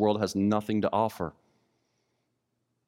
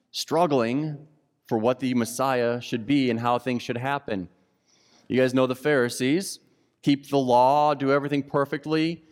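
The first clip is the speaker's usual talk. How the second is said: ordinary talk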